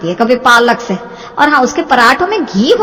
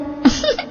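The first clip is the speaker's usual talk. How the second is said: breathy giggle; 14 semitones higher, 6 dB quieter than usual. Acted. Giggles at acting plan.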